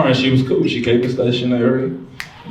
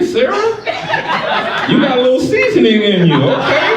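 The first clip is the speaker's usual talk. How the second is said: teasing excited exclaim; 13 semitones higher than usual. Retold or imitated. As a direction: tease her like she surprised you. Teasing date about soul food.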